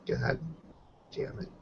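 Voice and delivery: ordinary delivery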